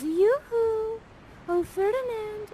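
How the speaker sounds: put-on very high, sing-song girlish call.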